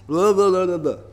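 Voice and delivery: slurred, run-together nonsense syllables; drunken babbling. Acted. Isaiah mocking the drunken leaders, babbling. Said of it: "are just drunken babblers".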